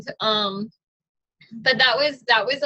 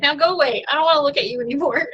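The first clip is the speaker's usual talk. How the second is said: laughing; 4 semitones higher, much faster than usual. Retold or imitated. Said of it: "she started laughing".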